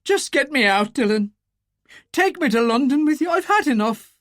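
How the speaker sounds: begging Dylan to get him out, desperate. A narrator's reading. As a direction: plead frantically to be taken home. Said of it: "Callahan sounded desperate".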